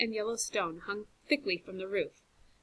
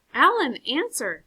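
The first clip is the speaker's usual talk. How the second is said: much louder, higher, pleading yells; urgent shouting; 8 semitones higher, 9 dB louder than usual. Acted. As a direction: yell for him to come back. Calling into darkness for light.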